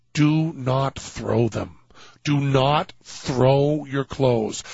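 slow, each word stressed: do not throw them; solemn slow advice to any future Miss Nevada; emphatic warning. Real, not acted. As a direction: spell the lesson out slowly, weighing every word. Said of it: "I want to just underline it".

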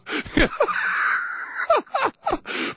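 high breathless burst of laughter; helpless high laughing fit; big laugh. Real, not acted. Host cracking up over the pull of the weasel, helpless laugh.

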